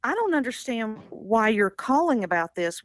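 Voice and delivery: usual speaking voice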